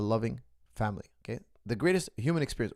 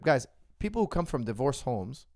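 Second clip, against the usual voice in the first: ordinary delivery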